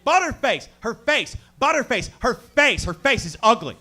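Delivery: high, loud dumb bro voice; friend's voice. Retold or imitated. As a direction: squeal it like a crude friend. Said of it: "And he's like".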